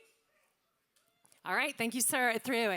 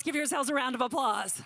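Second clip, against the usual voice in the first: usual speaking voice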